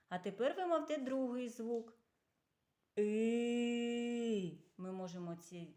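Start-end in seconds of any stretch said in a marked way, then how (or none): 3.0-4.6 И held long on one even note; drawn-out vowel. Shown as a demonstration. Teacher shows children the vowel can be sung, drawn-out И. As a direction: sing out a long, steady И. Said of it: "цей звук проспівати".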